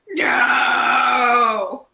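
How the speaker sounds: long, loud drawn-out wail of "no"; dismayed cry. Retold or imitated. Calling after a departing star in retelling, mock-despairing shout.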